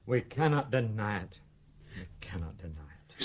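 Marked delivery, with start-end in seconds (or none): 2.0-3.2 much softer, like a distant echo; faint echo. Acted. Father's admission echoes faintly, much softer. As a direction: let it fade away as an echo. Father repeats it, far quieter.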